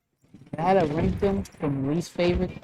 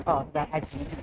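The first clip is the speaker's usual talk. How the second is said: ordinary delivery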